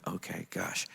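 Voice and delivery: very soft, low grumble; meekly giving in. Retold or imitated. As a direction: back down quietly, a little put out. Retold cowed reaction to strict rules.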